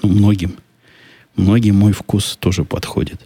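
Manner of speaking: normal talking voice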